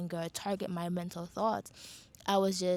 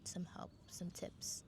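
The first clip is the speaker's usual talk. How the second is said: whispered; 10 dB quieter, slower than usual. Real, not acted. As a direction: drop to a breathy whisper. Softening advice to viewer.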